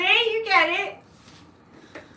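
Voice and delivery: Boston accent. Shown as a demonstration. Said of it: "that accent".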